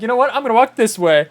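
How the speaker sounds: high loud triumphant retort; loud proud quote. Retold or imitated. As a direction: announce it like a victory. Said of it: "And I was like".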